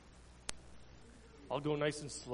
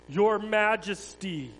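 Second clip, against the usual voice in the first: slow, mock-solemn bow; mock reverence; 6 semitones higher, 9 dB louder, slower than usual. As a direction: pretend to honour him, mocking. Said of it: "pretended to bow down to him".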